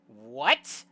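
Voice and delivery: loud What swooping up; loud, rising shock; shocked outburst. Acted. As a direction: yell it in startled disbelief. Startled reply to narrator's accusation.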